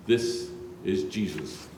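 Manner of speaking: ordinary delivery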